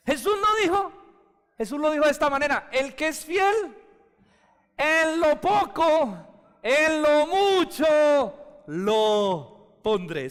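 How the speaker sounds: high, loud preacher's cry, slowed on the verse; preaching at full force. Acted. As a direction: proclaim it to the whole church, slowly. Preacher quoting Jesus at full force. (predicando a todo pulmón)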